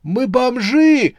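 high, drawn-out shout of despair; desperate cry. A narrator's reading. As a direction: wail it out loudly, stretching the words. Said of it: "в отчаянии крикнул кто-то". (отчаянный крик)

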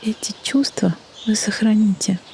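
ordinary talk, nothing marked

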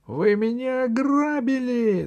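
very high, strained cry; outraged yell. A narrator's reading. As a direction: cry out as a robbed pygmy. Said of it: "закричал пигмей". (истошный высокий крик)

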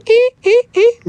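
high squeaky crank sounds; window crank noise. Shown as a demonstration. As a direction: imitate winding a window handle. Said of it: "нет давно уже ручки".

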